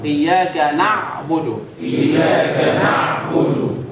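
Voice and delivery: اياك نعبد drawn out very slowly; slow recitation. Shown as a demonstration. Teacher reciting slowly for students. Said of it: "بس طبعًا بدون مبالغه".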